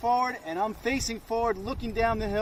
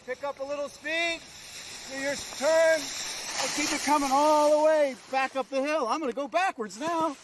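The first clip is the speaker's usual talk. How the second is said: cheery ski instructor shouting; 5 semitones higher than usual. Real, not acted. Instructor calling out while skiing.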